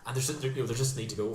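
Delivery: ordinary talk, nothing marked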